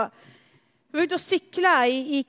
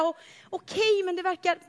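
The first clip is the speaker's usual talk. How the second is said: ordinary talk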